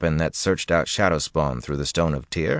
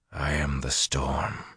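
low-key, slow, hushed murmur; whispered; 3 semitones lower, 7 dB quieter, slower than usual. A narrator's reading. Rand recalling his words as he channels, whispered. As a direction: say it softly and slowly, drawn out. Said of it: "he whispered".

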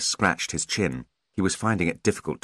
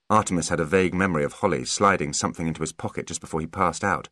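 plain reading voice

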